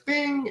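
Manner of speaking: a very high, soft little ding; mock idea ding. Brother realising the answer, a high soft ding. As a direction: chime it like a light bulb going on. Light-bulb moment in retold plot.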